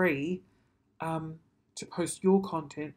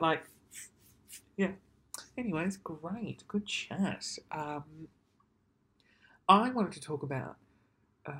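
ordinary talk, nothing marked

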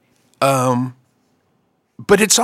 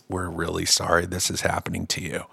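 hushed sympathy; 9 semitones lower, 10 dB quieter than usual. Retold or imitated. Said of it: "looking at me like".

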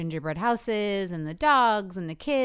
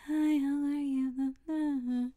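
slow, flat, bored greeting; bored small talk; 7 semitones higher, much slower than usual. Retold or imitated. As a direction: drag out the greeting, fed up. Mimicking tired small talk.

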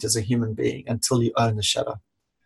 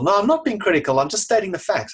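higher, indignant mock protest; defensive denial; 7 semitones higher, faster than usual. Someone denying criticism, indignantly defensive. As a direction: insist you're not being critical, a bit wounded.

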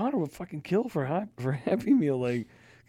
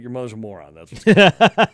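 snarky jab; 11 dB louder than usual. Retold or imitated. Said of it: "I'm like".

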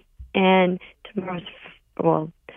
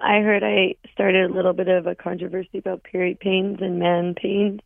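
ordinary delivery

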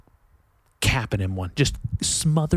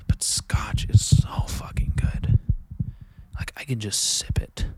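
whisper. Real, not acted. Praising scotch to listeners in a whisper. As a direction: savor it under your breath.

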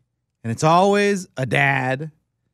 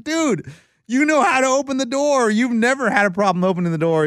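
retold exasperation; 7 semitones higher than usual. Retold exasperated reply to son.

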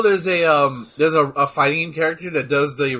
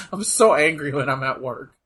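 ordinary talk

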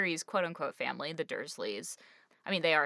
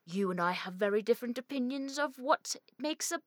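higher mock-dramatic film quote; movie character impression; 5 semitones higher than usual. Host quoting the movie confrontation, put-on dramatic voice. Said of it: "In the movie, it's just like".

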